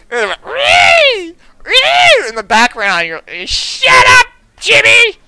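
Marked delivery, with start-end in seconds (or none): 0.4-2.3 imitated kid squeal. Kids screaming in background. 3.5-5.2 mock yell. Mother yelling at kid.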